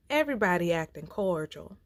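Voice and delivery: ordinary delivery, nothing marked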